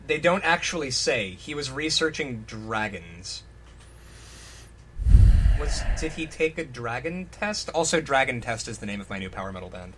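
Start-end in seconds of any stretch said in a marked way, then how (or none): none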